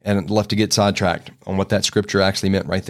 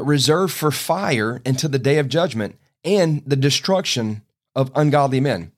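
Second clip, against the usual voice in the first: ordinary talk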